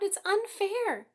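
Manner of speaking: high, loud and slow, tearful protest; sobbing. A narrator's reading. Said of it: "she sobbed".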